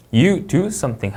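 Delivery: SOMETHING dropping at the end; finished sentence, SOMETHING falling; falling intonation. Shown as a demonstration. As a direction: close it by bringing SOMETHING down. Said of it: "하고 내려 읽어주시면".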